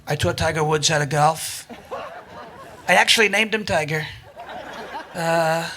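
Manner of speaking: low, unhurried boastful impression; measured politician's brag with long pauses; Donald Trump. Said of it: "Donald Trump loves to take credit".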